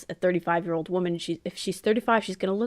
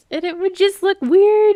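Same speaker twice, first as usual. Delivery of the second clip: emphatic; 13 semitones higher, 10 dB louder than usual. Real, not acted. Emphatic repeat of opinion.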